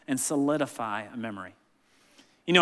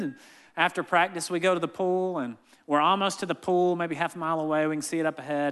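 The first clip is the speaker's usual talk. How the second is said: ordinary talk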